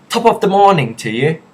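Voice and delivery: high, loud Irish greeting; mock Irish accent. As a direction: call it out in a put-on Irish accent. Accent talk, stock Irish greeting.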